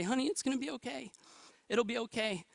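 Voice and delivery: soft, tender reassurance; reassuring. Retold or imitated. Comforting crying wife after bad news.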